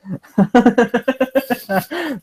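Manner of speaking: long real laugh; laughing at his own joke; cracking up. Real, not acted.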